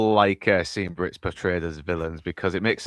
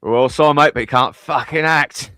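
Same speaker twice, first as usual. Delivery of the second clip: higher, louder Cockney imitation of a friend; mock Cockney; 5 semitones higher, 4 dB louder than usual. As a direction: ham up a Cockney mate, teasing. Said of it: "I do hear your Cockney come".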